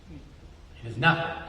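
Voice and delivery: quiet slow reassurance; hushed. Acted. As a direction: let it fall almost to nothing. God's answer to huge problems.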